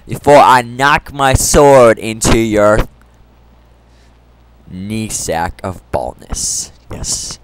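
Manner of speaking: ordinary delivery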